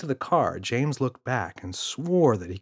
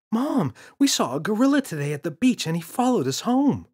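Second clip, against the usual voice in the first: put-on excited child; 11 semitones higher, 4 dB louder than usual. Boy telling mother.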